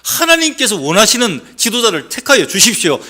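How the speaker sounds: loud, high fervent prayer. Pretend. Preacher modelling how believers should pray, loud and fervent. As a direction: cry out the prayer to God. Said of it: "이렇게 기도해야 됩니다".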